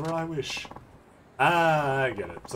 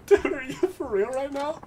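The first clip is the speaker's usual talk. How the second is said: disbelief through laughter; 4 dB louder than usual. Real, not acted. Laughing at the noble's claims.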